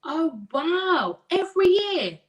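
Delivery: loud, high-pitched astonishment; real amazement, voice jumping up; amazed. Real, not acted. Amazed at a viewer's message.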